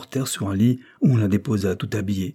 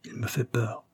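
softer, hushed confession; murmured; 2 semitones higher, 7 dB quieter than usual. Acted. Girl murmurs her fear into her father's ear, softly. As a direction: murmur it into his ear, afraid. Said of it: "murmura-t-elle à l'oreille de son père".

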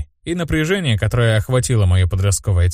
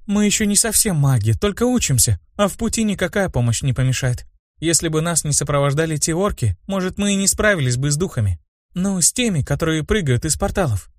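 lightly voiced girl, pitched higher; a higher voice for a chatting mage girl; young mage girl; 7 semitones higher than usual. A narrator's reading. Girl debating guards with her friend, lightly voiced.

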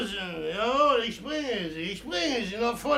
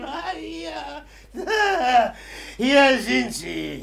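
usual speaking voice

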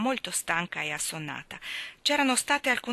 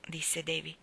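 plain reading voice

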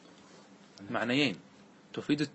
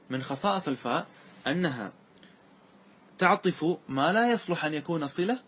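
normal talking voice

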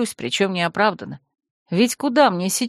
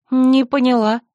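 higher, louder, stress on «Не»; sharp offended «не поняла»; baffled; 5 semitones higher, 5 dB louder than usual. Acted. (возмущённое недоумение)

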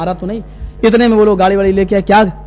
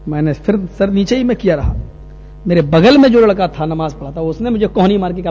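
usual speaking voice

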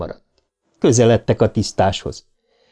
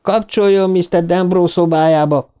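higher, pressing and urgent; urgent demand; 8 semitones higher than usual. A narrator's reading. Eddie demanding the night porter put him through, urgent. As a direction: get through to him, fast. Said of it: "mondta Eddie".

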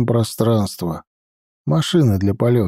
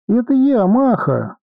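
put-on high child voice over radio; child's voice; 9 semitones higher than usual. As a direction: squeak it like a small kid. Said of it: "пропищал детским голосом". (высокий детский голос)

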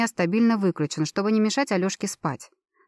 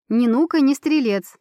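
playful exclamation; 5 semitones higher, 5 dB louder than usual. Acted. Playful family catchphrase.

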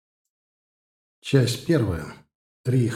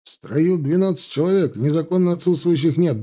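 raised, brisk report to an officer; higher-pitched soldier's roll-call report; military report; 5 semitones higher than usual. A narrator's reading. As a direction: report formally to a superior on parade. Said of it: "докладывает младший унтер-офицер Гончаров".

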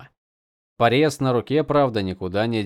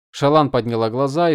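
plain reading voice

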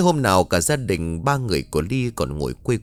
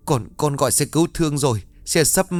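out of breath; 5 semitones higher than usual. A narrator's reading. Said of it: "thở hồng hộc".

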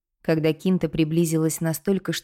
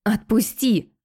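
higher, louder demand to let go; sharp cry; 5 semitones higher, 4 dB louder, slower than usual. A narrator's reading. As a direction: shout it while pulling away. Heroine breaks free from grip.

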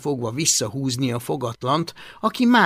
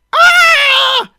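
very high, loud, drawn-out scream; bellowing scream; 13 dB louder than usual. A narrator's reading. Big man walks in on mess, screaming. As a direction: let out a long high yell. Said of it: "üvöltötte termetes Plépofa".

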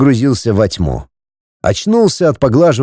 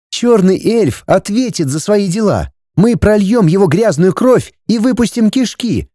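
higher, fierce female voice; vengeful young woman; 7 semitones higher than usual. Acted. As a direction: swear bloody revenge as the girl. Ulrika vows revenge.

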